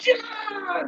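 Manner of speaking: high, sharp sword kiai; kiai shout. Shown as a demonstration. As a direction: yell it as you cut. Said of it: "I do".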